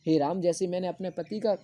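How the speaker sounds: ordinary talk, nothing marked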